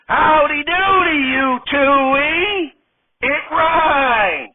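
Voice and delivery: high, goofy character voice; high goofball drawl; [name] goofy voice. Acted. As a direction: play it dopey and pleased with himself.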